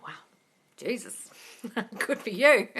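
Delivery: ordinary talk, nothing marked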